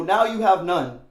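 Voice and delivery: gloating through laughter; mock gloat, laughing; laughing taunt. Taunting quote while laughing.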